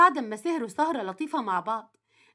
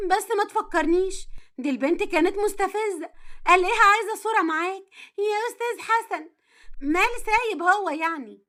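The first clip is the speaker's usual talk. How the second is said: higher-pitched wife, annoyed and mocking; indignant jealous young wife; 4 semitones higher than usual. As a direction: voice a jealous wife grumbling about a rival.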